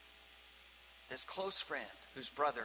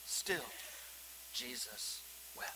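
usual speaking voice

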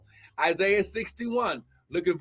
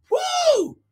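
a very high, loud yelp of joy; ecstatic whoop; 4 dB louder than usual. Real, not acted. Preacher bursting out over the coming wealth, ecstatic high whoop. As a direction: throw out a sudden cry of praise.

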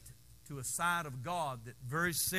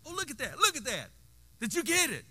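high, loud, delighted squeal; higher, louder excited mom; excited mother; 7 semitones higher, 6 dB louder than usual. Retold or imitated. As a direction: gush like a mom catching the smile. Mother excited by baby smiling.

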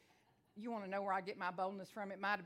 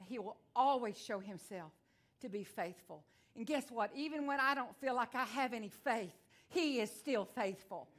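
preaching; 2 semitones higher, 4 dB louder than usual. Acted. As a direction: declare it to the congregation. Preaching at full force.

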